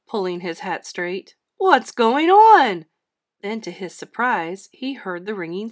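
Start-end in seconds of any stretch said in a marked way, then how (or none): 1.6-2.9 loud, high, drawn-out demand; bewildered holler; startled Santa. A narrator's reading. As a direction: exclaim in alarm, stretching the words. Santa startled by sudden tug.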